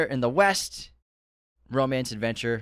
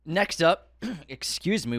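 ordinary delivery

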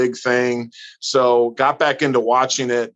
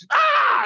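very high yelp of effort; mock shout. Imitating Gotch's grab again, a much higher mock yelp. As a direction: squeal the grab cry way up high. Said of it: "He's like".

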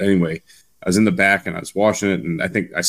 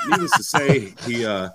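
usual speaking voice

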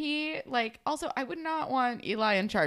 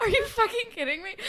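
laughing disbelief; 11 semitones higher than usual. Retold or imitated. Viewer reacting to creepy Fitz, laughing disbelief. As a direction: exclaim it incredulously through a laugh.

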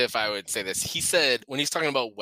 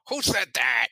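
impersonating him; 4 dB louder than usual. Retold or imitated. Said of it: "she kinda impersonates him going".

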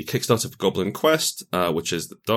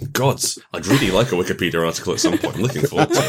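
laughing; 5 semitones higher, 6 dB louder, faster than usual. Real, not acted. Laughing about wanting a Wikipedia article.

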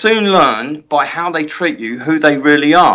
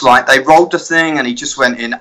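ordinary talk

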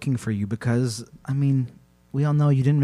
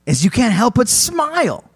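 hammed-up, dramatic campfire storytelling; horror narration; 3 semitones higher, 5 dB louder than usual. A narrator's reading. Host tells co-host of deadly tickling, dramatic horror narration. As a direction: build each phrase to an ominous peak.

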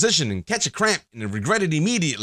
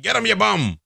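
high, raised cornerman's yell; Mickey yelling; 6 semitones higher, 3 dB louder than usual. Retold or imitated. Trainer urging Rocky on from the corner, mock yelling. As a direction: imitate a boxing trainer yelling at his fighter. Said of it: "with Mickey yelling from the corner".

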